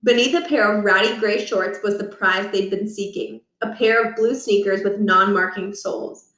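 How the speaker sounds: plain reading voice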